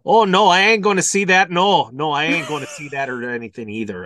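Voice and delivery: put-on loud Milwaukee accent, settling down; joking Milwaukee accent. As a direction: refuse in a hammed-up Milwaukee accent.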